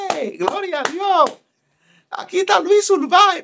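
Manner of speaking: high, hammed-up shout of glory to God; excited announcement. Young preacher announcing Luis Urbáez to the church, high excited shout. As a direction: call him out to the crowd like a fired-up preacher.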